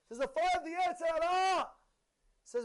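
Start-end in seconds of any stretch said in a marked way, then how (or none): 0.2-1.7 high, louder dramatic cry; retold outcry, raised voice. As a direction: call it out like an alarm. Rabbi cries out the punchline.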